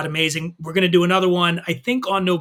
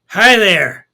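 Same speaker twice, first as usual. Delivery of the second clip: put-on slurred drunk; 7 dB louder than usual. A narrator's reading. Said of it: "slurs the stranger".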